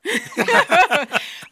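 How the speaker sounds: sudden high laugh; loud burst of laughter. Real, not acted. Laugh erupts before question.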